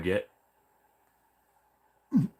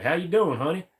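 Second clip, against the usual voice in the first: higher, lilting West Virginia southern greeting; a friendly 7-Eleven lady in West Virginia southern accent; 7 semitones higher than usual. Retold or imitated. Clerk greeting a regular, higher West Virginia southern accent. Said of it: "she's always like".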